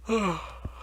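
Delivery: long tired yawn. Real, not acted. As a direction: let out a real sleepy yawn. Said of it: "Sorry, yawn".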